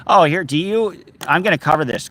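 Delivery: ordinary talk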